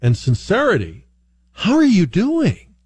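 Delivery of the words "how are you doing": high, drawn-out sincere question; earnest greeting. Retold or imitated. Radio host imitating Southern strangers, earnest and high. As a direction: ask it like you truly care. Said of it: "asked with great earnest and sincerity".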